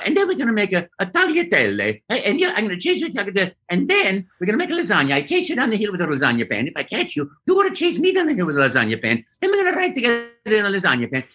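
high-pitched, excitable Italian cartoon voice; Mario. Actor rambling on through his audition, high giddy Italian voice. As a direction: keep rattling on as a playful Italian plumber. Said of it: "I just kept on".

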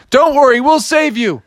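high, rallying rescuer's call; heroic firemen. Acted. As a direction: call out reassurance as a hero.

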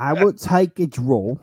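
slow, drawn-out mock English accent; mimicked English accent. Co-host playfully copying his friend's Liverpool line, English accent. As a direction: copy a British accent, stretching the words. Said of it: "that's a good accent".

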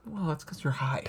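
teasing unvoiced whisper. Real, not acted. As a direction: whisper the jab aside. Teasing aside.

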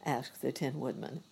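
plain reading voice

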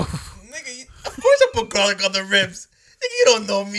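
loud, high retort, acted out; indignant comeback. Cook to a doubter of his garlic ribs, indignant.

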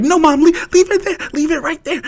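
put-on high whiny little boy; kid voice.

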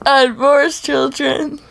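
mock deep voice. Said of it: "What was that?".

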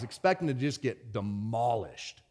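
ordinary talk, nothing marked

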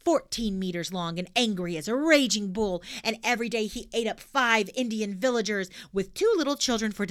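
put-on loud, bold little girl; cheeky girl voice. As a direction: blurt it out brashly. Pippi tells a tall tale.

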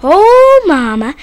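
loud, drawn-out call. A narrator's reading. Boy calling to his mother that his brother fell in, loud cry. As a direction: cry out slowly at full volume, starting high. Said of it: "raised his voice and cried".

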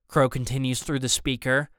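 ordinary delivery, nothing marked

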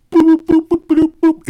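high, loud robotic boops; imitated computer beeps. Salesman demonstrating the AI writing a show, high beeping. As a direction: pop out rapid-fire machine boops.

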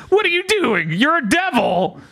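very high, incredulous mock outcry; comic alarm. Bat watching Denji warn the girl off, comic alarm. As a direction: pitch it way up in comic disbelief.

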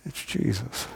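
softly naming Jesus in awe; hushed reverence. Acted. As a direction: drop to an awed near-whisper.

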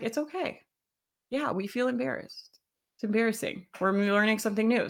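soft, soothing self-talk; gently calm yourself down; calm reassurance. Retold or imitated. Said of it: "it's just like".

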